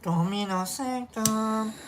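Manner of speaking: usual speaking voice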